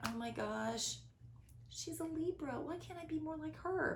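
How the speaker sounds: soft, hushed envious wondering; envious self-talk. Retold or imitated. Comparing herself to a Libra friend, softly envious. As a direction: murmur it to yourself, wishing. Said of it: "instead of going".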